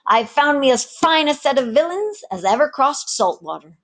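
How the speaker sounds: a one-armed rogue selling gold to sailors; swaggering pirate mate. Acted. Pirate mate lures the tavern crew, loud and swaggering. Ginger boasts.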